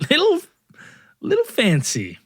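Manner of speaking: high-pitched start, hammed-up awe; mock impressed. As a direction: play at being dazzled by luxury. Mock awe at fancy bar.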